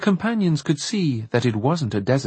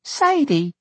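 put-on high, joyful little-girl cry. A narrator's reading. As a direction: exclaim it happily in a child's high voice. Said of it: "Laura Glue exclaimed joyfully".

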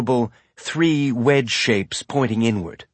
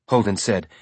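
ordinary delivery

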